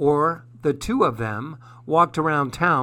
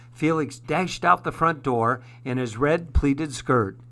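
usual speaking voice

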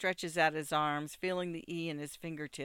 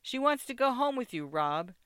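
raised, loud voice above the noise; shout over music; 8 semitones higher, 5 dB louder than usual. A narrator's reading. Drew tells Rob about the girl, shouting over music. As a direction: shout it into a friend's ear. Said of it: "Drew shouts in your ear over the music".

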